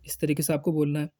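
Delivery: usual speaking voice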